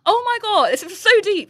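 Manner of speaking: high, loud, overwhelmed exclamation; hammed-up emotional overwhelm; retold tearful gushing. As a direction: re-enact being moved to tears. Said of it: "I just started crying".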